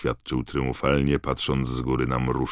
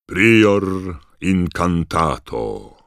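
loud, slow booming incantation; thundering spell; 6 semitones higher, 9 dB louder, much slower than usual. A narrator's reading. Diggory casts a spell on Harry's wand, booming. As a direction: boom the spell out like a command. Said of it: "zagrzmiał pan Diggory".

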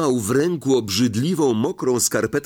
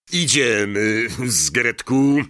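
cold command; slower than usual. Acted. Malfoy orders his elf.

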